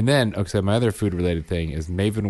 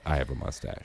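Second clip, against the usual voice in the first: quiet; 6 semitones lower, 10 dB quieter than usual. A narrator's reading. Story trails off quietly.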